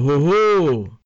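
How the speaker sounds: loud, high, booming Santa laugh; hearty Santa ho ho. Acted. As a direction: belt out a jolly ho ho. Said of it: "ein kräftiges Hoho". (lautes, fröhliches Weihnachtsmann-Hoho)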